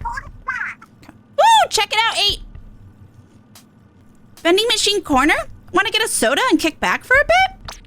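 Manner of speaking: Pearl voice. Acted.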